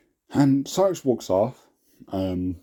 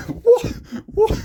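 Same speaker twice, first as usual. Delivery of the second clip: a high, cracking cry of disbelief; shocked shriek; 4 dB louder than usual. Real, not acted. Creator rereading his old fanfic, shrieking in disbelief. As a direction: shriek it, appalled.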